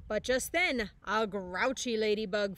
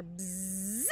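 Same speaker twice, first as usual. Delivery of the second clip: soft buzzing bee sound; buzzing noise; 2 semitones lower, 9 dB quieter than usual. Acted. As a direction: make a quiet buzz. Yellow jacket buzz.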